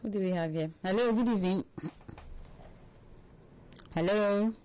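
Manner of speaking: ordinary delivery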